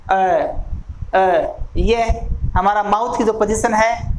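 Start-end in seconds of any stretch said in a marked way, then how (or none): 0.1-1.7 अ as a short central vowel; repeated vowel demo. Shown as a demonstration. Teacher repeating the central अ vowel for students. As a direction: say अ twice from the middle of the tongue. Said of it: "टंग के mid part से हम इसको उच्चारित करेंगे".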